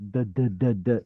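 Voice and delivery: repeated "da" with the voice moving; voiced D. Shown as a demonstration. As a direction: demonstrate the voiced D in "da". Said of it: "Your voice is moving".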